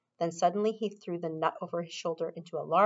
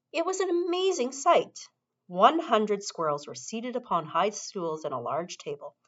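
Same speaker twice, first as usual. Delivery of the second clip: ordinary delivery, nothing marked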